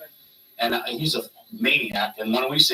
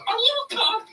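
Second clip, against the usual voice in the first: much higher, put-on voice of a wary promoter; high suspicious Paul voice. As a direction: squeak it out like a paranoid boss.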